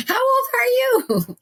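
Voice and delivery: very high, incredulous question; imitated amazement. Mimics amazed onlookers.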